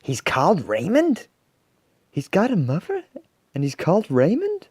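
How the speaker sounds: high, loud disbelieving questions; incredulous crowd reaction; astonished. A narrator's reading. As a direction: repeat it in amazement, voice leaping up. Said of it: "Everyone was astonished".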